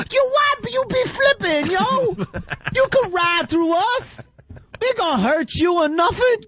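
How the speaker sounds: high, hammed-up street slang voice; exaggerated slang impression, laughing; mock street accent.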